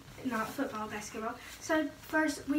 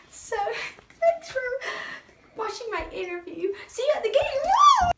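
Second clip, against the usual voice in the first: high, loud shouted goodbye; cheering sign-off; 9 semitones higher, 6 dB louder than usual. Acted. Cheerleader shouts goodbye to viewers, high excited cheer. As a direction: yell it like a pep rally.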